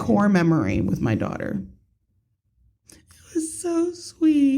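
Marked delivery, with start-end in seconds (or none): 2.9-4.6 high, slow, choked-up retelling; half-crying as she repeats it; teary. Real, not acted. Retelling a touching moment.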